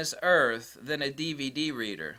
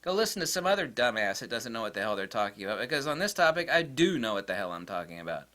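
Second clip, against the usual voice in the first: usual speaking voice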